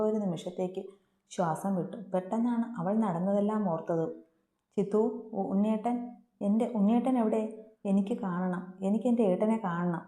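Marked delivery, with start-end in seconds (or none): none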